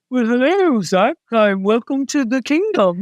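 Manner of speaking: lightly voiced, stately God greeting; a hint of a solemn heavenly welcome; mock formal God. Imitating how people think God greets the host, lightly formal. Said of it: "people think God says".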